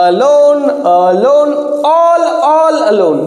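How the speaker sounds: alone with weight on lone, all all alone drawn out slowly; teacher marking stress aloud; stress demo. Shown as a demonstration. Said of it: "জোর দেওয়া হচ্ছে".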